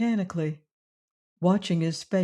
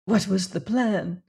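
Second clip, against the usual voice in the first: hoarse. A narrator's reading. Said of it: "she asked hoarsely".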